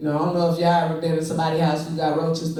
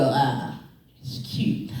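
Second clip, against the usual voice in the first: whispered aside; grandpa whisper; 4 semitones lower, 3 dB quieter, much slower than usual. Retold or imitated. Grandpa commenting on his grandson's crush, whispered. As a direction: murmur it under your breath. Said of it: "And he go".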